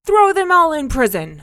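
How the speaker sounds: very loud, high yell; furious royal order. A narrator's reading. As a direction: bellow the sentence. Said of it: "cried the king".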